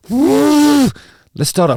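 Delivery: very high, loud, stretched trumpeting; mock elephant trumpet call. Shown as a demonstration. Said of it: "Does that sound like an elephant".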